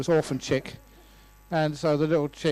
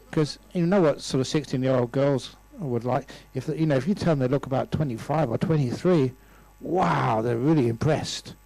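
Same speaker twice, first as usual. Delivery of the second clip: ordinary delivery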